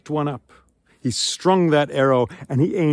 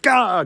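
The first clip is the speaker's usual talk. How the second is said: choking gasp; 10 semitones higher, 7 dB louder than usual. A narrator's reading. Antinous hit in throat.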